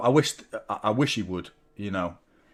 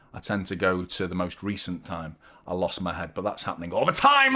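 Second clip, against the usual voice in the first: ordinary talk, nothing marked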